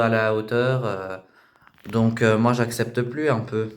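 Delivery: mother complaining about her daughter in an interview; tired mother's confession. Acted. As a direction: confide your exasperation to the camera.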